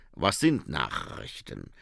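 put-on low, slow accented vampire question; Otto Schreck. Vampire asks, puzzled. (tiefe, langsame Vampirstimme)

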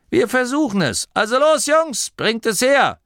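high, loud rallying call; lautes Kommando. A narrator's reading. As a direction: rouse the helpers into action. Inventor orders his helpers about. (laut und hoch angetrieben)

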